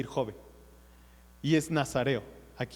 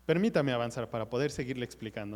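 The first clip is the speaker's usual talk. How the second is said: normal talking voice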